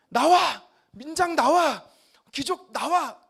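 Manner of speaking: loud, high-pitched angry shout; angry summons. Acted. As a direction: call them out like a furious leader. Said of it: "너무 화가 났어요".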